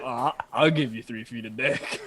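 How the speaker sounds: lightly voiced low doctor; a hint of a low-voiced doctor; mock doctor. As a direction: play a doctor agreeing, pitched well down. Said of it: "And the doctor's like".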